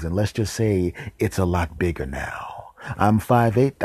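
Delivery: ordinary talk, nothing marked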